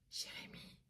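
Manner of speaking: chuchoté tout bas, très doux; voix douce chuchotée. Retold or imitated. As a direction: souffle le prénom à l'oreille, doucement. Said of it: "mais une voix tellement douce". (murmure très doux à l'oreille)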